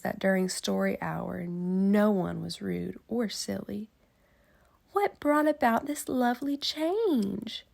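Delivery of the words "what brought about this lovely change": higher, sweet curious question; pleased teacher. Acted. Miss Nelson noticing the kids behave, higher and sweet. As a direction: ask it with gentle wonder. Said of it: "she asked".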